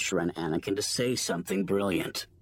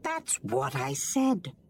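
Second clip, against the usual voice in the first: put-on high, slow little kid voice; child voice; slower than usual. A narrator's reading. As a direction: play an insistent little youngling. Youngling insists, high child voice.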